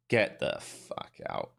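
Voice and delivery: low, unvoiced gasp of disbelief; hushed get-out-of-here; breathy disbelieving aside. Real, not acted. Disbelief at American words.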